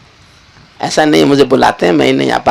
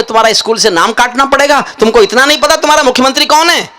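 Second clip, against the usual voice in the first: a mildly angry scolding, pitched up; angry inspector; 8 semitones higher than usual. Retold or imitated. School inspector scolding a pupil, mock angry. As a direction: scold the boy with mock outrage. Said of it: "inspector को गुस्सा आ गया".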